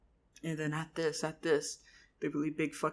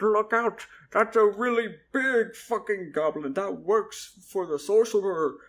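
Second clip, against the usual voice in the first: higher, louder yell of warning; warning shout; 5 semitones higher, 9 dB louder than usual. Acted. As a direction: shout to warn him from behind. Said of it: "Charles behind me went".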